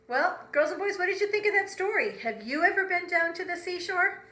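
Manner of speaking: usual speaking voice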